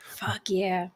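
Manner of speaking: a soft unvoiced whisper of agreement; quick hushed yes from a listener. Real, not acted. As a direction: breathe it out under your breath. Quiet agreement in the conversation.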